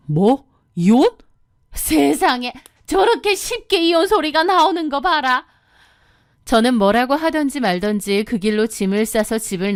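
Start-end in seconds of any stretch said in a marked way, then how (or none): 0.1-2.5 shocked outcry. Retold or imitated. Husband shocked when she says divorce. 2.9-5.4 high, scandalised mother-in-law; tutting mother-in-law. Retold or imitated. Mother-in-law tutting at the word divorce.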